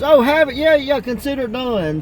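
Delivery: high, eager yes-man voice, pitched well up. Retold or imitated. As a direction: chirp it out like a clueless helper happy to oblige. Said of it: "And he goes".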